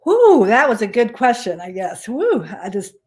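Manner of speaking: breathless after coughing; choking. Real, not acted. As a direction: speak through a choked throat. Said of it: "it went down the wrong way".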